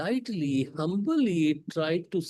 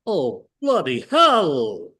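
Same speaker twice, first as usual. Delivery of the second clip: slow, louder mock British exclamation; British accent; 9 dB louder, much slower than usual. Speaker imitating British debaters, mock British accent. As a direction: drawl it like a stuffy Englishman, British accent. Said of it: "in a very British accent".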